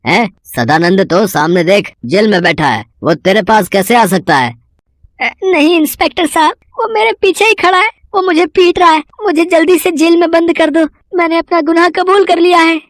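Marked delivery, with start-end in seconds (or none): 5.2-12.8 very high, breathless frightened pleading; high frantic voice begging for help; panicked confession. Acted. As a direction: blurt it all out to the inspector in terror. Insists he is being beaten.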